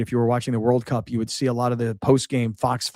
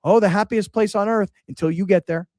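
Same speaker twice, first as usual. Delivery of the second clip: Billy Ray joking; 7 semitones higher than usual. Retold or imitated. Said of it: "Billy Ray would go".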